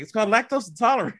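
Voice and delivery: high, quick exasperated correction; arguing with the TV. Retold or imitated. Viewer correcting someone on TV, high exasperated arguing. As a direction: correct them fast and high-pitched, exasperated. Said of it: "I'm sitting there arguing with the T V".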